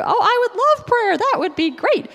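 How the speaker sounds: high, loud delighted gush with a laugh; laughing, squealing yes to prayer. Retold or imitated. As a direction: gush like she's thrilled to be asked.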